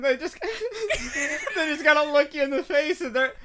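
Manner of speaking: high, laughing words squeezed out. Real, not acted. Host laughs at the demon friend joke, high. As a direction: laugh up high through the line.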